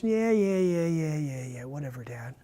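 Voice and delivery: fading, bored yeah yeah to dad; dismissive brush-off. Retold or imitated. Kid tuning out a yelling dad, dismissive and fading.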